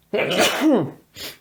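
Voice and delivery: sudden loud cough; one hard real cough. Real, not acted. Coughing fit after sneeze.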